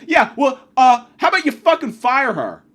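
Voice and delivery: retold yell. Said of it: "And I go".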